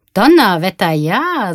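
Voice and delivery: loud, drawn-out astonishment; swooping exclamation of wonder; amazed. A narrator's reading. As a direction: exclaim it, impressed and surprised. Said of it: "sagte der Mann verwundert".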